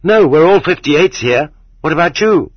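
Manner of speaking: naive, high-voiced protest turning to a question; hasty reassurance. A narrator's reading. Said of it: "hastened to reassure him".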